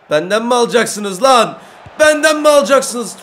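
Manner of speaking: rising loud taunt; taunting shout. Real, not acted. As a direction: dare them to take the ball. Taunting defenders while dribbling.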